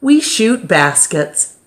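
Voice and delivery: plain reading voice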